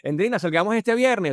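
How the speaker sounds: high, loud invitation; wrong seduction voice. Shown as a demonstration. Asking Endrina out the wrong way, high and loud. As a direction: blurt the invite out at full pitch. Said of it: "Yo, yo no te voy a decir".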